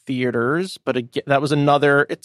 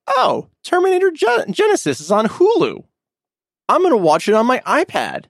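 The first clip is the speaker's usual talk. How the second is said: excited quote; 10 semitones higher than usual. Retold or imitated. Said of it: "I was like".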